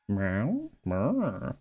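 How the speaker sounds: flat cat meow. Shown as a demonstration. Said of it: "like, a bit flatter?".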